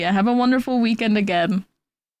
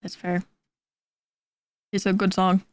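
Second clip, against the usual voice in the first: ordinary talk, nothing marked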